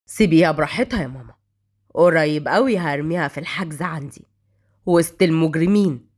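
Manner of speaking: low-pitched male character, menacing calm; cool threat. A narrator's reading. Brother warns he will jail his sister, cool threat. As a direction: threaten in a deep, measured voice.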